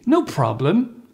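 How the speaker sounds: "No problem" high with a rising end; high pitch intonation. Shown as a demonstration. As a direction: model a friendly lilt on "No problem". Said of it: "try to do it with me".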